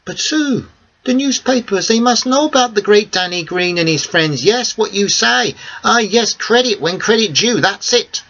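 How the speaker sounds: excited Greek cafe owner insisting on credit; Theo, excited. Acted. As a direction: pitch him up high, pleading for credit. Theo pleads for newspaper credit.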